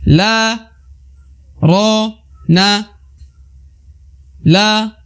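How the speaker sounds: la, ro, na chanted high; recitation drill. Shown as a demonstration. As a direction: call each letter out clearly. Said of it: "teman-teman bisa ikuti".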